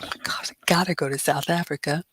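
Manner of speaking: unvoiced, breathless thrill; excited whisper. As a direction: whisper it like a secret wish. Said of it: "And I was like".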